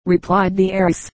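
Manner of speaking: usual speaking voice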